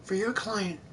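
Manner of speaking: hushed, halting counsel; softly guide, a phrase at a time; soft guiding voice. Acted. Mentor's voice reaching Phoenix, soft guidance. Mia's remembered voice advises Phoenix.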